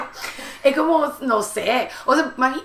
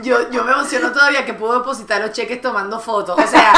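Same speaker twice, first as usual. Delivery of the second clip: normal talking voice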